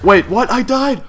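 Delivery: high, loud disbelieving yelp; shocked shout. Real, not acted. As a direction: cry out in shock at a sudden loss. Shocked by sudden death in game.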